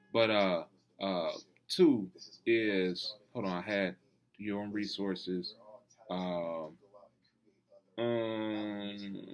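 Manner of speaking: losing his train of thought, slow; brain freeze. Real, not acted. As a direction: brain freeze, groping for the word. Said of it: "damn, I just got brain freeze again".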